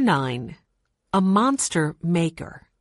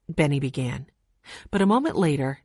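plain reading voice

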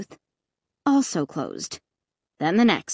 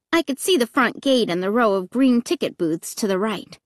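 usual speaking voice

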